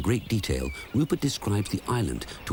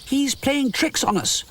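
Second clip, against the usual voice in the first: much higher, slow imp voice; imp crying out. A narrator's reading. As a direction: accuse the bear indignantly. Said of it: "the imp cries".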